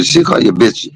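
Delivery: normal talking voice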